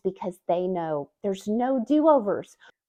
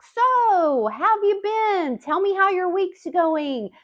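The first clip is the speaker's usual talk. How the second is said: higher, louder mock caller making small talk; acted small-talk opener, much higher and louder; 9 semitones higher, 3 dB louder, slower than usual. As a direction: play the other person warming up with small talk. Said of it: "making small talk".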